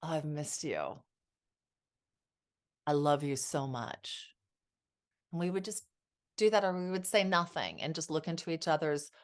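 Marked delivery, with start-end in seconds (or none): 0.0-4.3 tender whisper-soft. Retold or imitated. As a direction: murmur it like to a husband just home. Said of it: "It was".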